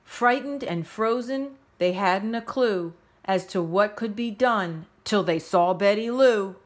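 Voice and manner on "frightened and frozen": usual speaking voice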